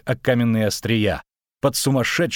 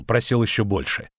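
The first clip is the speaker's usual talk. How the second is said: ordinary delivery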